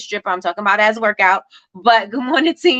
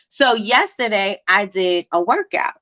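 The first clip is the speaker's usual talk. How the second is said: normal talking voice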